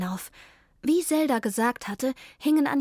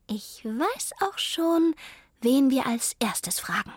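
higher, slow, fading to a whisper; secretive Zelda; 3 semitones higher than usual. Acted. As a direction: let the plan slip out slyly.

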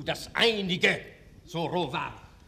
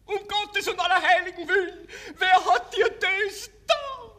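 anguished mother; 7 dB louder than usual. Acted.

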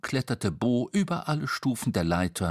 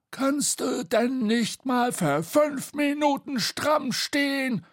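high, slow, indignant squawk; scolding; 3 dB louder than usual. A narrator's reading. Bo scolding Tete after the fall, indignant. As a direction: tell off crossly, stretching each word. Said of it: "schimpfte Bo".

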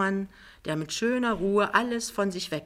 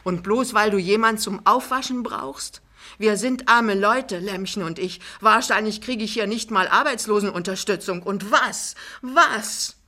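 louder, higher, mounting desperation; ever firmer; 5 semitones higher, 7 dB louder than usual. A narrator's reading. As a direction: press on harder with each phrase. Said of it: "fährt immer fester fort". (immer fester und lauter)

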